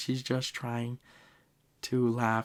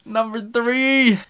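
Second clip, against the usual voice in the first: much higher, louder, slow hype call; excited announcement; 11 semitones higher, 10 dB louder, slower than usual. Real, not acted. Counting down sad anime scenes, a loud slow hype call. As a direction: announce it like a big reveal, slowly.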